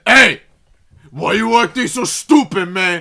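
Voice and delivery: loud, high-pitched confrontational shout; angry dad yelling. Retold or imitated. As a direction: imitate an irate father storming over. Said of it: "like, a yelling match at each other".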